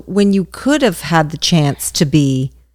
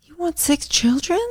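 incredulous whisper; 8 semitones higher, 5 dB quieter than usual. Real, not acted. Shocked at six children.

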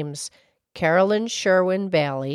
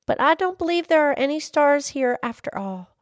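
high little-lass voice; high-pitched girl character; little girl calling; 10 semitones higher, 4 dB louder than usual. Acted. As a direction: object politely in a high child's voice. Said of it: "she called to the old mill wheel".

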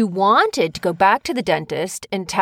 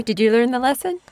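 ordinary talk

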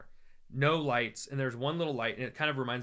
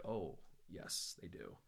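much softer, lower sly reply; hushed bluff; 6 semitones lower, 15 dB quieter, slower than usual. Retold or imitated. Traveler bluffing to the kiln owner's son, soft low sly reply. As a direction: murmur it like a secret.

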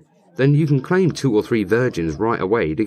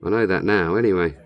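ordinary delivery

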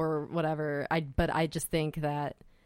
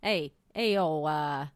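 higher, louder hammed-up New York accent; mock New York accent; 6 semitones higher, 3 dB louder than usual. Host imitating a New Yorker plotting for the town, New York accent. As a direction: play a New York hustler pitching a scheme. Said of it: "Was that Boston-y?".

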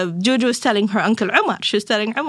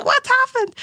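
sharp, much higher, a panicked mother's cry; alarmed question. Retold or imitated. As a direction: shoot up in pitch in shock. Mother gets alarming school call.

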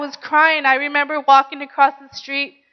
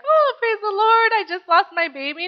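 mock cheerful praise; 6 semitones higher than usual. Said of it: "I wasn't happy, like".

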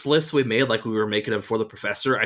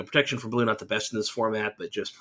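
usual speaking voice

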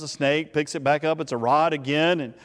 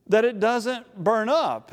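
usual speaking voice

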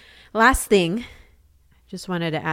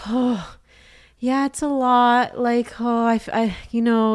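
higher-pitched weary sighing mom; retold exasperation, halting and raised; exasperated; 6 semitones higher, 4 dB louder, slower than usual. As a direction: imitate a worn-out mother admitting it's a lot. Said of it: "like, exasperated".

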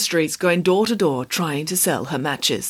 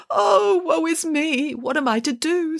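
put-on high, woeful little-girl wail; melodramatic lament; 10 semitones higher than usual.

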